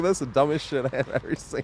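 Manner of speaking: blurting it out mid laugh; laughing retort. Retold or imitated. Mocking the teammate's play, laughing.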